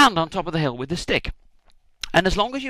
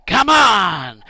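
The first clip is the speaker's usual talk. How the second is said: loud, higher yell of encouragement; a lone weak rallying cry; cheering shout; 5 semitones higher, 8 dB louder than usual. Retold or imitated. Moses just standing there cheering, loud yell. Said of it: "just going".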